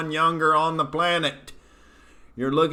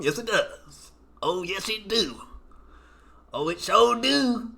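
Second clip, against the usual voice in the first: Okie accent; 5 semitones higher, 3 dB quieter than usual. Shown as a demonstration. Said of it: "it would go right with the accent".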